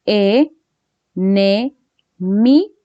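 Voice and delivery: enemigo broken into four separate syllables; "e, ne, mi, go", each syllable on its own with a pause after it. Shown as a demonstration. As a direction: count out the syllables slowly for a class. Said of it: "¿Cuántas sílabas tiene?".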